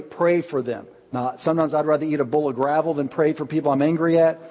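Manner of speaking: normal talking voice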